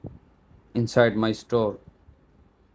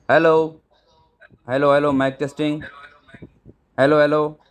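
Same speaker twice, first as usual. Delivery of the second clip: loud, raised "hello, hello" into the camera mic; mic test call; 4 semitones higher, 4 dB louder, faster than usual. Shown as a demonstration. Testing the camera mic by calling out loudly. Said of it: "so we can talk like this".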